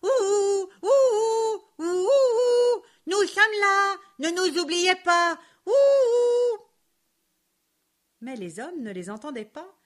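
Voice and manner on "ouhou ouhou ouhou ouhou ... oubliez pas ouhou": high, loud calling out; shouting together. A narrator's reading. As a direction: call out to be noticed. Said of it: "en criant en chœur".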